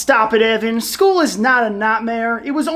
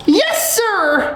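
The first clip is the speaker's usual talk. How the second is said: high, obedient schoolboy; high-pitched teacher's-pet reply; eager pupil; 7 semitones higher than usual. Acted. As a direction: answer the teacher too eagerly.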